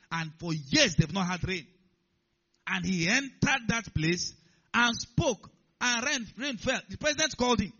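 fervent, booming sermon delivery; preaching at full force. Real, not acted. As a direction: proclaim it to the whole congregation in emphatic bursts.

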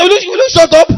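loud, high-pitched burst of rebuke; scolding shout. Retold or imitated. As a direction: shout her down sharply. Scolding the frightened helper.

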